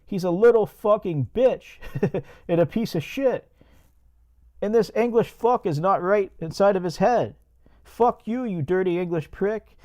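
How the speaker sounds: Bo's phone-call voice. Acted. Bo reporting Creepy English by phone, high put-on caller voice. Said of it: "So Bo makes a call from a different phone saying".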